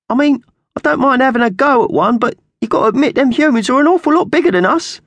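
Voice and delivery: loud, very high squawky question; doubtful magpie henchman. Acted. Slasher doubts the plan.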